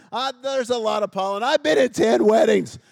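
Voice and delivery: high, loud, exasperated complaint; bitter friend voice. Retold or imitated. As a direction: whine it like a bitter bridesmaid. Imitating bitter bridesmaid friend.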